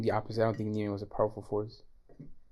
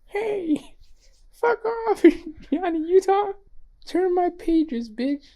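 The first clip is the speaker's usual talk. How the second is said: much higher, louder squealing yell, slow; higher-pitched impression; 8 dB louder, much slower than usual. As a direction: squeal the quote way up high. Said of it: "going higher pitched".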